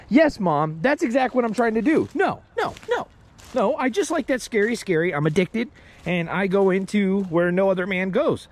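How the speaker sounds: high-pitched sarcastic answer to mom; hammed-up thrill seeker; mock defiant reply. Acted sarcastic reply to scolding mom.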